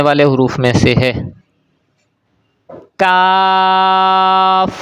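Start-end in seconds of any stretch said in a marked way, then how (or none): 3.0-4.7 کاف held long with an open, unrounded aa; drawn-out کاف with a plain k; thin, light letter. Shown as a demonstration. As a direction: recite کاف lightly on a steady note. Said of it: "باریک پڑھیں گے".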